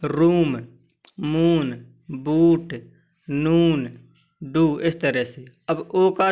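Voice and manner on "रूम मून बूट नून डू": रूम, मून, बूट, नून with a held 'oo'; long OO. Shown as a demonstration. Teacher drilling रूम, मून, बूट for learners, long OO. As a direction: draw out the vowel in रूम, मून, डू, one word at a time. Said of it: "बड़ा ओ के अर्थ में उच्चारण देख लो".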